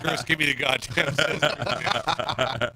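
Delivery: loud, slowed mock exasperation; exasperated retold demand. Pinned angler demanding scissors from Chris, exasperated. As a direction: demand it loudly and slowly, half laughing.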